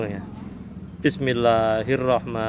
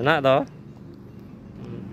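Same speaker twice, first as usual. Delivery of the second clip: usual speaking voice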